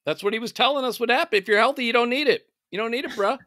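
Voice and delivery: high, scornful mock advice. Mocking Rogan's advice.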